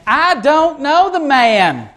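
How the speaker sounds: high, drawn-out Southern denial; Southern accent. Acted. Peter as a Galilean denying Jesus, Southern accent. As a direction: deny it in a broad Southern drawl. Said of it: "Let's say that Galileans sounded like that".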